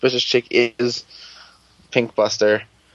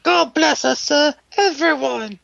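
disgruntled Tiny Tim. Retold or imitated. Mocking fans in a Tiny Tim whine, very high. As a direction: chirp it like a bitter Dickens child. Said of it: "disgruntled Tiny Tim".

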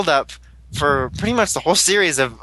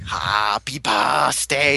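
very slow, lower, unhinged mock greeting; crazy villain; 3 semitones lower, much slower than usual. Fan voicing the crazed cake-baking boss. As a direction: drag it out like a madman. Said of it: "he's like, crazy".

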